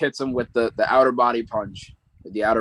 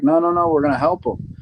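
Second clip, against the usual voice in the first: higher, pressing insistence; urgent protest; 7 semitones higher, 4 dB louder than usual. Retold or imitated. Peter stopping Strange from locking up Goblin, urgent and higher. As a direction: jump in to stop him, pitched up. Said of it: "he's like".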